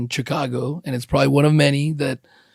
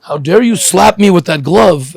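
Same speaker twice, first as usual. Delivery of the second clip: offended gentleman; 3 semitones higher, 6 dB louder than usual. Retold or imitated. Gentleman slapped with a glove, hammed-up louder indignation. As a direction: play a huffy nobleman demanding satisfaction. Duel challenge over slap with glove.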